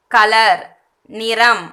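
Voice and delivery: ordinary talk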